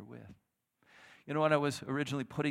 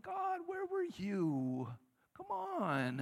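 much higher, drawn-out cry to God; anguished cry; much slower than usual. Acted. Believer crying out to God, high and drawn out. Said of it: "It's like".

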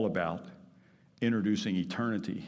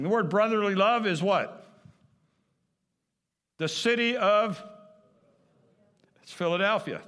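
preaching at full force; 9 semitones higher, 5 dB louder than usual. Acted.

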